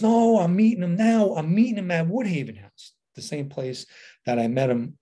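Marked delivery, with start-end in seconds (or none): 0.0-2.9 higher-pitched, emphatic imitation of Pete; insistent retold caller. Pete pressing the speaker to come meet someone, mock insistence. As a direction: push back insistently, voice jumping up.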